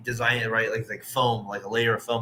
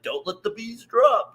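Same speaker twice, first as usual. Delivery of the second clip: imitating the bee guy; 9 semitones higher, slower than usual. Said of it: "the guy's like".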